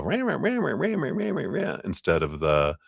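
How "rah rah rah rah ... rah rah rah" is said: high nagging rah rah babble; mock chatter. Mind's noise mocked as high nagging chatter. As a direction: parody a droning crowd in a high squeak. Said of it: "hearing all the".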